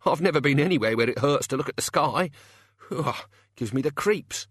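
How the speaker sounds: high-pitched jittery objection; high, anxious gang member; uneasy henchman. Acted. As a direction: pipe up nervously. Chicken Wire voiced, uneasy.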